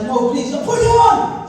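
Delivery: high, loud whining plea; pleading. Retold or imitated. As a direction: beg desperately, voice rising. Pleading with mum.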